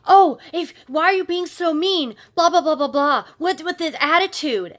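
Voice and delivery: mocking a coworker's loud, high tirade; hammed-up yelling complaint, pitched high; ranting coworker. Coworker accusing the narrator of being mean, ranting.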